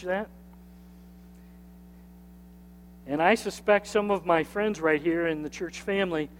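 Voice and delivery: soft, hushed pastoral prayer; closing prayer. Acted. Pastor praying over his church family, hushed and soft. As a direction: pray quietly over the church.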